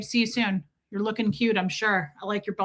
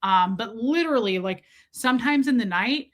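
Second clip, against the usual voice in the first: ordinary talk, nothing marked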